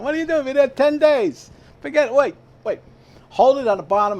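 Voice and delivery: high, loud impatient yelling; brother yelling. Retold or imitated. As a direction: shout instructions at a clumsy kid. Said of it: "My brother was yelling".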